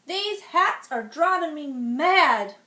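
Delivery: loud, slow royal outburst; fed-up king, loud and slow; exasperated king. Acted.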